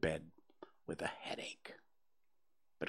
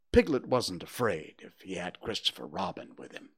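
ordinary delivery

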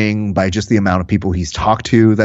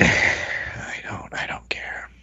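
sighing, barely audible whisper; 12 dB quieter than usual. Real, not acted. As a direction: let it drop to a whisper. Deflated aside, whispering.

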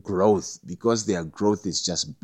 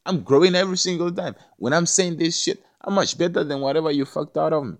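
fired-up retort; 7 semitones higher than usual. Retold or imitated. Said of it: "It's like".

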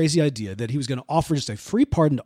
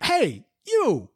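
high, hammed-up accusing call; pirate calling out; 9 semitones higher than usual. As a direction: play a pirate singling out a captive. Pirate singles out a captive.

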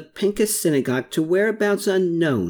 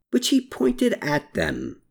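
plain reading voice

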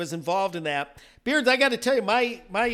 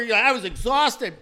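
usual speaking voice

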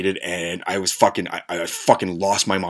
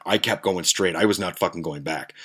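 ordinary talk

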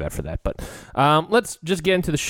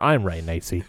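low, soft mock-announcer introduction; mock grand intro; 8 semitones lower, 3 dB quieter than usual. Husband hamming up introducing his co-host wife. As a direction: introduce her like a gala host. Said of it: "mock grand introduction of wife".

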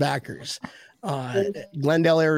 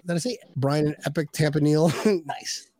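usual speaking voice

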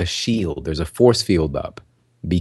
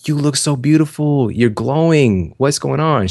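gushing praise; 6 semitones higher than usual. Retold or imitated. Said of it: "they're like".

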